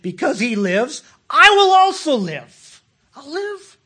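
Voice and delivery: loud high preaching cry, slowing; rising shout. Real, not acted. Preacher crying out to the congregation, rising shout. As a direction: proclaim it with full force.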